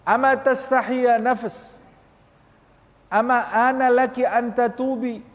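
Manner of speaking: impassioned Arabic rebuke of the self; fervent rebuke. Shown as a demonstration. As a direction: cry out at your own soul. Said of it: "Tanya kepada nafsu kita".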